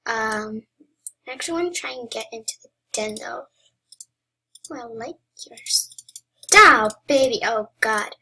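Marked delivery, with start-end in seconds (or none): 4.7-7.4 loud, playful come-on; flirty tease. Pretend. Gamer flirting at a rival player, playful. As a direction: tease them like a smooth flirt.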